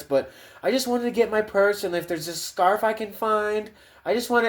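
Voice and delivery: put-on high airy horror-movie girl voice; high mock scream-queen voice; ditzy movie girl. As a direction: play the clueless girl going for her purse. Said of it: "that typical girl in the movie".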